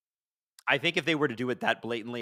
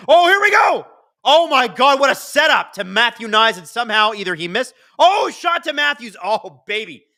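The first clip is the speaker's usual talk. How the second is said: shouting high and loud at the chance; thrilled live hockey call, loud and high-pitched; excited play-by-play; 12 semitones higher, 11 dB louder than usual. Real, not acted. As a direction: erupt as the play develops, easing off after the miss and surging again. Live reaction to a scoring chance.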